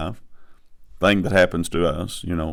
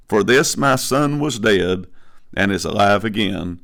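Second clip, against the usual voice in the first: raised, ringing Bible reading; scripture reading; 3 semitones higher than usual. Acted. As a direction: proclaim the verses to the congregation. Reads scripture aloud.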